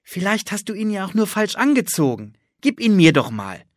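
a keen little sister coaxing; bright girl Anne, eager. Acted. Anne's voice held.

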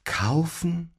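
Kaufen? breathed as a rapt whisper; dreamy whisper. A narrator's reading. Claudio echoes the word, lost in a whisper. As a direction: hush it in wonder. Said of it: "Claudio schwärmte unbeirrt weiter".

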